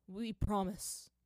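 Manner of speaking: very slow, hesitant promise; father slowly promising to return; slow lie. A narrator's reading. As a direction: promise while hiding a lie. Father lying gently.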